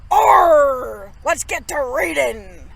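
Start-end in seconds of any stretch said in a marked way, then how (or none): 0.1-1.1 pirate arr. Acted. Pirate captain throwing away a bloody oar, loud arr.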